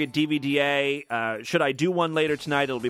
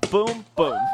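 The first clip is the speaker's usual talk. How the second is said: boom sound effect; 7 semitones higher, 4 dB louder than usual. Retold or imitated. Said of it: "So it goes".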